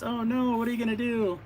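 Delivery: hammed-up damsel in distress, pitched high. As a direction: play the helpless singer. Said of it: "she goes".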